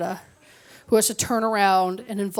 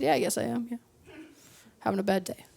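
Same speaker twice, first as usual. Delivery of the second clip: mock glum mumble; 9 dB quieter, faster than usual. Glum reply to Deb.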